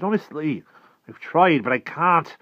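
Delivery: high, loud insistence; desperate protest. A narrator's reading. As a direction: plead your case, loud and high. Boy protests while held captive.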